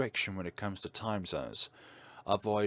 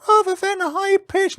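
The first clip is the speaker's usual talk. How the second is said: very high, much louder squeaky voice; high-pitched voice; 14 dB louder than usual. Shown as a demonstration. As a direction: leap way up into a squeak. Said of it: "That sudden high-pitched jump scared me".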